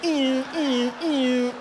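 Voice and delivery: high, loud mocking jeer; loud squealing mockery, fading out. Mocking the failed video store.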